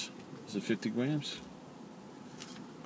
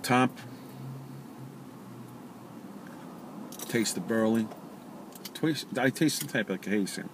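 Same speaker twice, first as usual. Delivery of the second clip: talking around pipe stem; puffing while speaking; pipe in mouth; 3 semitones higher, 4 dB quieter than usual. Real, not acted. Seen 0: Pipe in mouth while lighting it, stem kept in mouth after.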